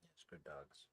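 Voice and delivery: ordinary talk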